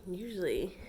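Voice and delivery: ordinary talk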